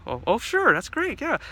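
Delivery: high, loud flustered acceptance; eager Dick. Acted.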